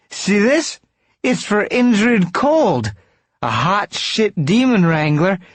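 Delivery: put-on loud, very high protest from a drunk; squeaky drunk, indignant; high-voiced drunk. A narrator's reading. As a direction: plead your case in a high, loud drunken voice. Drunk Mike pleads his case.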